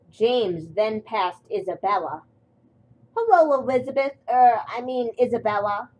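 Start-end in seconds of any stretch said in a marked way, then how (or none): 3.2-5.9 light, high, louder cheery hello; breezy greeting. A narrator's reading. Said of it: "whistled James".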